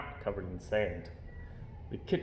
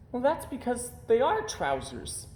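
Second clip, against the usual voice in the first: crossly; 11 semitones higher than usual. A narrator's reading. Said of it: "said Little Bear crossly".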